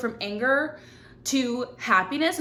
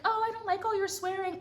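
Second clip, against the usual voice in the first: prissy critic; 9 semitones higher, 7 dB quieter than usual. Retold or imitated. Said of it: "people are like".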